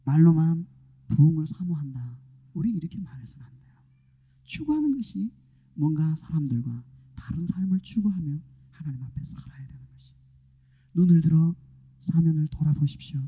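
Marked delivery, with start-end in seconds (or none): 2.5-3.8 a fast, hushed warning; quick whisper. Real, not acted. Preacher warning the congregation in a quick whisper. 8.7-10.1 fading whisper. Real, not acted. Preacher trailing into a whisper before the people.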